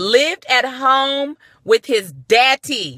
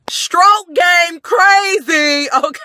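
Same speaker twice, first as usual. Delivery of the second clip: high, loud and drawn out slowly; slow, stretched punchline yell; hyped shout; 10 semitones higher, 6 dB louder, much slower than usual. Acted. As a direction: stretch it out like the big reveal. Hyping up the punchline.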